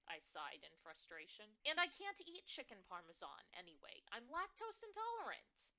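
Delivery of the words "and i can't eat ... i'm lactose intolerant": frustrated daughter. Acted.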